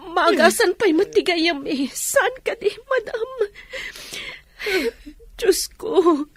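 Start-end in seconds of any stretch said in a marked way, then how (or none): 1.9-6.3 tearful, breaking voice as she begs; crying plea. Acted. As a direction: plead through tears. Pleading over a sick friend. (agsangsangit a agpakpakaasi)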